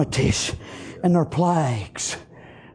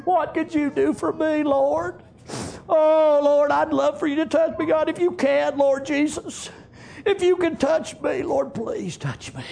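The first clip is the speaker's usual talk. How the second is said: pleading; 10 semitones higher than usual. Acted. Leper begging Jesus to touch him, pleading. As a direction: beg for it, letting the last words drop away.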